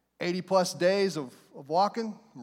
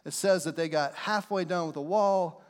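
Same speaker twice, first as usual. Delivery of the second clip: ordinary delivery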